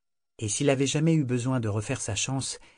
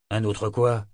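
irritated; 3 dB louder, slower than usual. Acted. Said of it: "d'un ton irrité".

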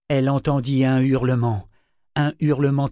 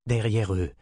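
ordinary delivery, nothing marked

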